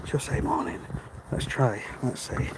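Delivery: hushed whisper as she nears; whispering so the passing woman won't hear. Real, not acted. Whispering on a dark morning walk as a neighbour approaches.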